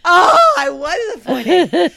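loud, high "oh" of remembering; sudden realization. Real, not acted. Reminded by co-host about the accent, loud realization. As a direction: burst out as it all comes back.

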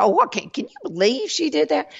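high, mock-shocked Southern gossip. Southern folks judging what someone did, in high mock-shocked gossip. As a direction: play a scandalised Southern lady clucking over a neighbour. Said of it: "that's just so Southern".

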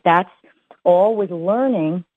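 usual speaking voice

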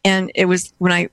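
ordinary delivery

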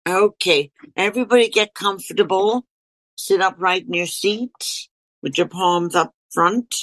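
gentle meditation guide voice; guided meditation. Acted. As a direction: ease them into stillness.

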